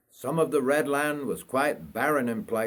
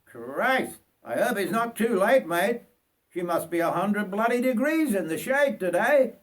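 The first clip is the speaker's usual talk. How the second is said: higher conductor voice; conductor calling; 8 semitones higher than usual. Acted. Conductor walking through the carriage, higher voice. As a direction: pitch the conductor up high.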